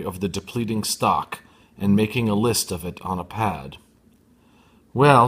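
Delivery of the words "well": half-serious, half-amused. Acted. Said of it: "in that half-serious, half-amused way of hers".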